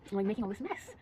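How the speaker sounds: ordinary delivery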